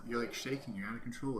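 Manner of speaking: ordinary talk, nothing marked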